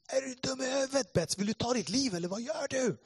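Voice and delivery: high-pitched, incredulous telling-off; angry police scolding. Retold or imitated. Policemen scolding him after the scuffle, angrily.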